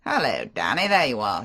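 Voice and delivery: a flashy charmer making excuses; Favell. Acted. Favell voiced.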